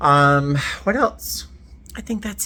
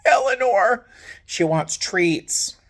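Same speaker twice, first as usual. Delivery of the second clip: high, slow voice for the cat asking for treats; cat's voice; 8 semitones higher, slower than usual. Retold or imitated. Speaker voicing her cat Eleanor asking for treats at her ear, high and slow. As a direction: voice the cat begging sweetly. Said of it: "she comes up next to my ear and goes".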